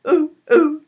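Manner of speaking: loud high monkey ooh ooh; monkey hoots. Pretend. Leader hooting like a monkey to end the action song. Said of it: "Shall we try again?".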